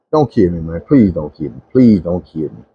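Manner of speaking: low, repeated mock plea; pleading. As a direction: beg for mercy in a sunken voice. Begging attacker to spare him.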